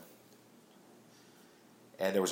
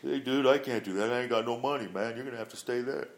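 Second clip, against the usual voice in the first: imitated buddy; 4 semitones higher than usual. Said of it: "Dave's reply quoted".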